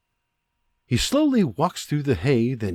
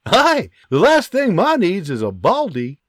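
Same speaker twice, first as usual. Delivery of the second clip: joking; 7 semitones higher, 4 dB louder than usual. Acted. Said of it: "Robert jokes".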